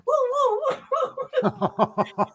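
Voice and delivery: high, drawn-out woo-woo; high-pitched imitated woo sound; imitated towel whooshing. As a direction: make spooky woos for a moving towel. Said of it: "the towel is in the bathroom just going".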